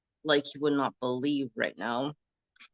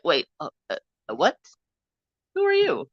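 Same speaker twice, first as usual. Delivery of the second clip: retold surprise; 2 semitones higher, faster than usual. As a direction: squeak out the question in surprise. Shocked recognition retold.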